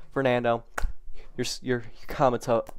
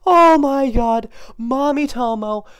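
overjoyed; 7 semitones higher, 7 dB louder, slower than usual. Real, not acted. Joy mother alive.

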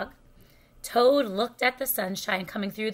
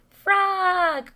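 high, raised call; crying out; 7 semitones higher, 5 dB louder than usual. A narrator's reading. Toad calls to Frog from the stage, crying out. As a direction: call out loudly for a friend. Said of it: "cried Toad".

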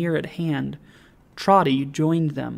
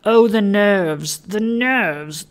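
higher, drawn-out lament; pious exclamation; 5 semitones higher, 5 dB louder, much slower than usual. A narrator's reading. Pious alderman bemoans human frailty, exclaiming. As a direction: wail it mildly, stretching the words. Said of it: "exclaimed the pious alderman".

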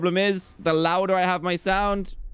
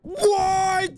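high startled yelp; yelping what in shock; shocked cry. Real, not acted. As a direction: cry out in sudden fright. Jumpscare shock in horror game.

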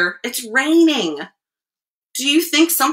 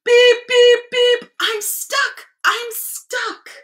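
little truck crying out; 13 semitones higher, 3 dB louder, much slower than usual. A narrator's reading. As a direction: squeak out a plea for help. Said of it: "cried the little blue truck".

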